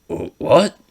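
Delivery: high, loud startled shout; stunned what. Acted. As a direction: blurt it in shock. Katsuki stunned.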